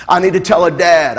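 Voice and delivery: ordinary talk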